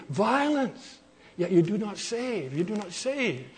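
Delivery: softly voiced prayer, fading on the repeat; subdued lament. Prophet cries to a God who won't save, subdued lament. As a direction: let the complaint sink away softly.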